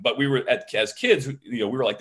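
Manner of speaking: normal talking voice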